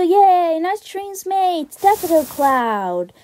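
high, stretched-out announcement; drawn-out, celebratory introduction; happy cheer. Real, not acted.

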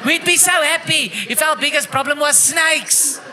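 high, shouted comic punchline; worked-up comic tirade; exuberant rant. Acted. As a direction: cry it out as if overjoyed. Mock rant reaches its punchline.

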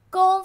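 工 high and level; flat tone. Shown as a demonstration. Teacher breaking down work for learners, level tone on 工. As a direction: hold 工 steady at a high pitch.

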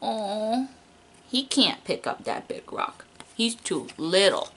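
ordinary talk

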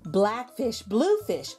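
ordinary delivery

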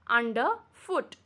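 Under, pause, foot; split into parts. Shown as a demonstration. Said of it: "Under is one and foot is another".

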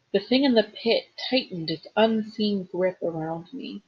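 ordinary delivery

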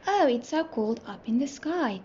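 ordinary delivery

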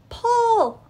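high, loud command; shouted. A narrator's reading. Anna on the rope to Elsa, shouting. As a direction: shout the order up to a helper. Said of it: "Anna shouted".

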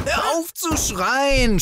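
high, slow scolding cry; scolding yell. Acted. Narrator telling screaming Arnold to stop, high scolding. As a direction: tell him off, drawn out.

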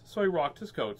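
plain reading voice

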